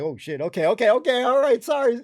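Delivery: rattled mock apology after being ordered; flustered compliance. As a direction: jump to it, rising as you go. Acted hurried reply to a barked order.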